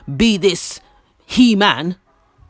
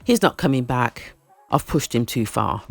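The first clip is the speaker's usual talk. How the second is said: soft, low inner voice; mild imitation of a worried wife; despairing inner thought; 6 semitones lower, 5 dB quieter than usual.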